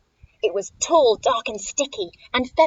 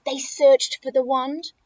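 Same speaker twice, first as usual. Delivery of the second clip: plain reading voice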